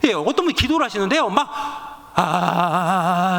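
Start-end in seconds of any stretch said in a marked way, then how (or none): 2.2-3.4 low drawn-out 아 moan; imitated prayer moan. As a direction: groan it like someone praying in pain. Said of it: "이러면서 기도를 하세요".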